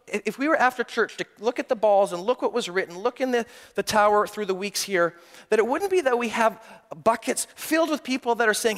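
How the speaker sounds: ordinary talk, nothing marked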